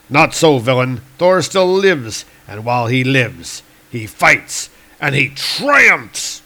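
heroic declamation building to a stretched last word; triumphant thunder god. Acted. Thor's voice from the explosion, heroic and triumphant. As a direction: proclaim it phrase by phrase.

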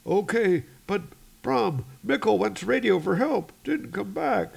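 high-pitched anxious report; worried crewman. Acted. Crewman reporting a missing radio man, high and worried. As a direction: pitch it well up, uneasy.

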